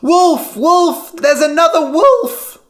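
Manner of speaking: high, loud alarm call; shouting wolf. A narrator's reading. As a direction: bellow the false alarm. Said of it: "Lyman shouted".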